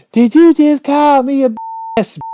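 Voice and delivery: mock her outrage; offended girlfriend. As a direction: girlfriend shrieking in shocked offense.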